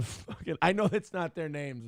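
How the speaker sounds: usual speaking voice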